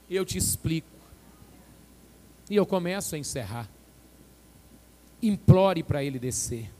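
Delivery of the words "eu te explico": normal talking voice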